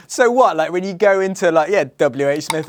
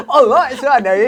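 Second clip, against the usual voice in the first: mock Cockney; 6 semitones higher, 4 dB louder than usual. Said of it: "Look at me talking like".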